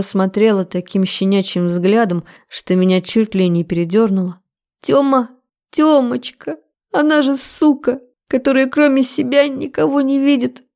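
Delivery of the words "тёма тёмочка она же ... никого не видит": high, wheedling female voice; higher, needy character voice; cloying pleading woman. A narrator's reading. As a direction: plead with him sweetly against a rival. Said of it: "от её приторных слов".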